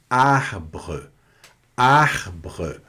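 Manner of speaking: arbre with both R's in the throat; arbre, R rasping in the throat; French throat R. Shown as a demonstration. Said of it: "pronunciado na garganta". (arbre com R na garganta)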